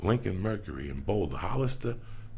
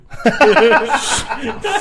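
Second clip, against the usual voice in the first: a big, high cackle that breaks out; real laughter bursting out; loud burst of laughter; 12 semitones higher, 13 dB louder than usual. Real, not acted. Laughing hard at his own story about turning down the stage, loud cackle.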